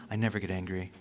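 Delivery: low, soft, suppressed stoic; stoic person. Acted. Stoic denying any buried anger, low and restrained. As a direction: say it quietly, keeping all feeling stuffed down. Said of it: "you're like the stoic person".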